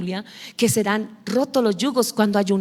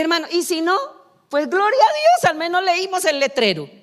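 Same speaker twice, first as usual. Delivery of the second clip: cheerful punchline; 8 semitones higher than usual. Retold or imitated. Preacher retelling telling her brother, laughing it off. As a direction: pop it up high with a grin.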